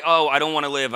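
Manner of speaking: ordinary delivery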